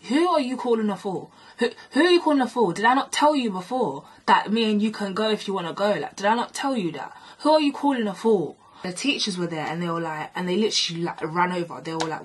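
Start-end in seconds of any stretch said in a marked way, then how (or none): 0.1-8.6 indignant challenge, pitched up and louder; heated, repeated demand for an answer; retold angry confrontation. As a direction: replay yourself having a go at someone.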